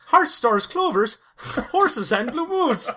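high comic Irish leprechaun voice; stage-Irish lilt breaking into laughter; mock Irish leprechaun. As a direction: play the cereal-box leprechaun, pitch climbing. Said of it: "could you please say hearts".

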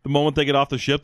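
usual speaking voice

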